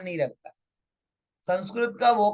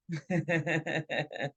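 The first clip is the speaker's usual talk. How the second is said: laugh; 4 semitones lower, 7 dB quieter than usual. Real, not acted. Laughing at rooster joke.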